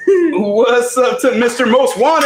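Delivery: slow announcer shout-out, last word stretched; hammed-up drawn-out introduction; ring announcer. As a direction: hail the viewer like a ring entrant. Greets viewer like ring announcer.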